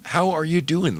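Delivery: normal talking voice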